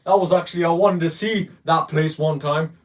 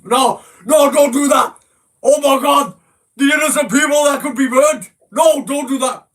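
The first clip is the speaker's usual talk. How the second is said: higher, louder hammed-up horror, pretending to be appalled; mock panic; 7 semitones higher, 4 dB louder than usual. Mocking a teammate on voice chat with hammed-up panic. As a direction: cry out in fake alarm over the innocent people. Mock alarm at imagined fire victims.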